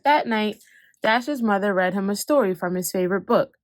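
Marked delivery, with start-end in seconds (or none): none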